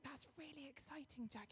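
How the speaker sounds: softly restrained congratulation; straight-faced. Retold or imitated. Hiding her own news from a friend, straight-faced. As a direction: keep the excitement down, very softly. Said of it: "keeping a very straight face".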